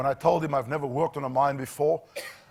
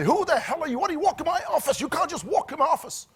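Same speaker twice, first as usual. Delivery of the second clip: high shouted boss, fast and angry; mock furious yell at the new guy; imitated yell; 10 semitones higher, faster than usual. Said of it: "he just yelled at me".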